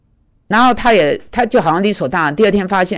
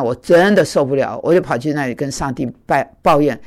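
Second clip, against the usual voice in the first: ordinary talk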